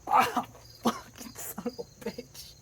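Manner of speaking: slow, high, strained swearing through pain; pained cursing. Real, not acted. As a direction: curse through real burn pain. Burned hand, cursing in pain.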